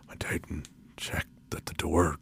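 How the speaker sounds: hushed storyteller whisper; low whisper building suspense; whispered narration. A narrator's reading. Spooky story, hushed telling.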